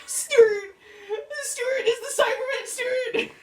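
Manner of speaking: shrill laughing cry. Retold or imitated. Voicing the little goth guy.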